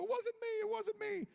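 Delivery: high, wailing sob-voice from a crying scene; acted crying. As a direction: wail it out as the audition cry. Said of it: "we do the crying scene".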